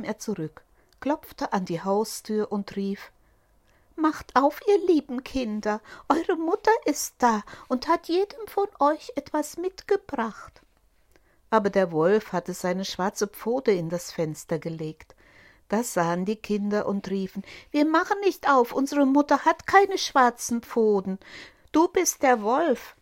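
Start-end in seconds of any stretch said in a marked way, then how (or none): 4.0-10.6 much higher, sweet put-on mother voice; wolf faking a fine sweet voice; fine disguised voice. Wolf fakes the mother's fine voice, much higher. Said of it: "machte damit seine Stimme fein". 17.7-22.9 put-on higher, louder defiant kids; kids calling out. Goat kids refuse the wolf, loud and high.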